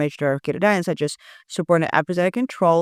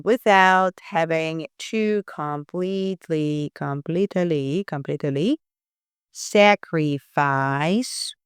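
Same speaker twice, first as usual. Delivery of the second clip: slow drawn-out read; much slower than usual. Shown as a demonstration. Drawn out while reading email.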